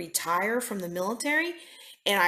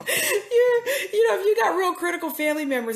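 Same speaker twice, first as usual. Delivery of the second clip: real laugh breaking through the words; 9 semitones higher, 4 dB louder than usual. Real, not acted. Pastor cracks up at her own advice, laughing. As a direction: laugh it out high.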